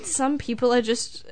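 normal talking voice

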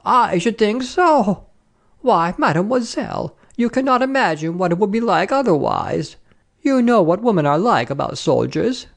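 high-pitched, scandalised madame; emphatic lady traveller. Acted. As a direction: lecture the young traveller knowingly. Voices the lady opposite.

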